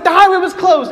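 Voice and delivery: high, loud defensive shout, acted out; yelling back. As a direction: act out a son hollering his excuse. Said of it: "we just have a yelling match".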